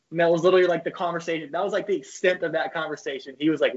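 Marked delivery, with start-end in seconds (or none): none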